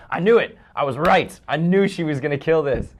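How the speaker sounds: loud, high, triumphant recalled cheer; over the moon. Retold or imitated. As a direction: relive an elated "told you so" moment. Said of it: "I was over the moon".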